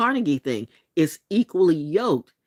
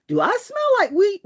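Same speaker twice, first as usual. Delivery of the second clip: mock inner question; 3 dB louder than usual.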